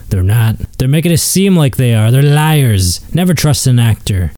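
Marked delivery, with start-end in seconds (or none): none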